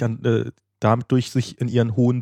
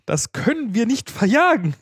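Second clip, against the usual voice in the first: slow, raised-pitch lament; slow, dramatic bear's reply; troubled storybook bear; 6 semitones higher, slower than usual. Acted. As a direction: plead slowly and theatrically to the bee. Bear's lament acted to the bee.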